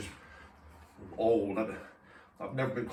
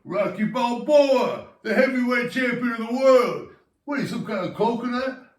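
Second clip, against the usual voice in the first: Rocky Balboa impression; 8 semitones higher, 5 dB louder than usual. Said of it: "You don't recognize me? Rocky Balboa".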